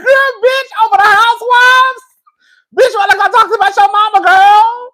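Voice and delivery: high, loud, incredulous yell; hammed-up indignant shout at the screen; outraged disbelief. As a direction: shriek it in shocked outrage. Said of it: "I said".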